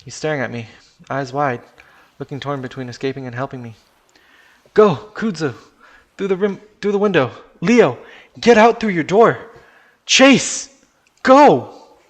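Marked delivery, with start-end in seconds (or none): 4.7-12.0 high, very loud urgent orders; screaming at everyone. Acted. Chase orders friends out of the sinking car, screaming. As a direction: scream commands to get everyone out. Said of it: "I scream at basically everyone".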